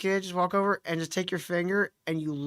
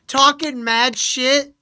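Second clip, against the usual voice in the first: usual speaking voice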